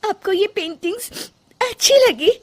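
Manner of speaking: high, hopeful question about her paintings; hopeful daughter-in-law. A narrator's reading. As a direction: ask with hope, in a high voice. Rajni asks shyly.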